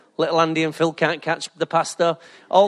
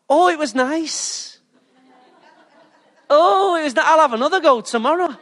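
mock blissful swoon; 9 semitones higher than usual. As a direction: sigh it out high and blissful. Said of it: "you go".

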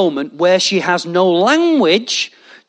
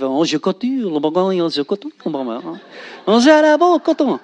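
hammed-up French accent, laughing; mock French. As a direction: gabble fake French and break up laughing. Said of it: "he's French [name]".

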